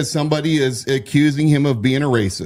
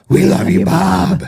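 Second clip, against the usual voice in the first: loud, higher drag queen voice; Terry Seymour; 5 semitones higher, 5 dB louder, slower than usual. Retold or imitated. Host imitating a drag queen calling to the sheriff, showy drag voice. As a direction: call it out in your best drag voice. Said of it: "in her best drag voice possible".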